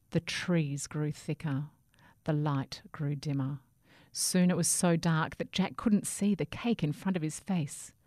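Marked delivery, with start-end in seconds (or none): none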